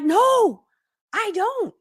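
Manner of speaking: high-pitched comic wail; dramatic despair. Retold or imitated. Wife answering her husband from the ground, dramatic. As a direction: wail the admission melodramatically. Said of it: "It was a very dramatic moment".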